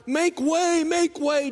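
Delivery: high, loud call to clear the crowd; a father hurrying everyone along, loud and high. Retold or imitated. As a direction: shout it to part the crowd. Father clears a path through the crowd.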